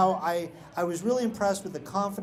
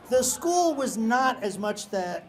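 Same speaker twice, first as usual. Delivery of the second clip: usual speaking voice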